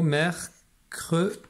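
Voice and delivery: "mercredi" slow, split as "mer... cre... di"; découpage en syllabes. Shown as a demonstration. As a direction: separate each syllable of "mercredi" with pauses. Teacher sounds out word by syllables. (« mer… cre… di », syllabe par syllabe)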